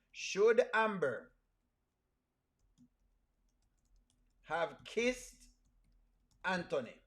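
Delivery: slow, broken up by long pauses; deliberate question. Real, not acted. Host challenging viewers about the kiss, slow and deliberate. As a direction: draw out "Should Amber", then wait before each part.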